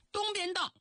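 high, ringing commander's call; rallying address. A narrator's reading. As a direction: raise the pitch to address the troops. Commander rallying his soldiers. (高声向战士们训话)

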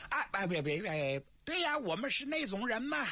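high, stammering nervous retraction; flustered backpedal. Acted. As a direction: fumble to take it back. (慌忙改口，结巴)

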